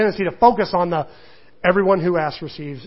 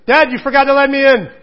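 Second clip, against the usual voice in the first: put-on higher, louder kid voice, a touch indignant; imitating son; 7 semitones higher, 7 dB louder than usual. Son at the bathroom door, mock indignant child voice. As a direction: play the little boy scolding his dad. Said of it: "he'll actually say".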